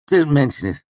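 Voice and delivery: high, loud and smug; preening self-satisfaction. A narrator's reading. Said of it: "Ambrose said smugly".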